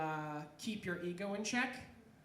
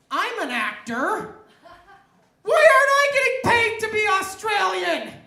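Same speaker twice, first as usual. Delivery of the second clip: rising from raised voice to high full shout; indignant outburst; 11 semitones higher, 17 dB louder than usual. Acted. Comic reacting to the paid actor theory, indignant shout. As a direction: build to an outraged comic yell. Acts out his indignant thought.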